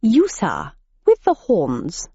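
put-on plummy old English gent; lightly voiced posh gentleman. A narrator's reading. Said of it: "crumpets and tea at four o'clock sharp".

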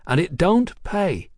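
high-voiced cheeky London girl. A narrator's reading. Hetty answers the compliment.

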